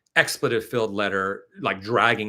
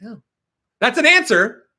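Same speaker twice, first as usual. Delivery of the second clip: excited; 12 semitones higher, 10 dB louder than usual. Retold or imitated. Excited reaction to actress's answer.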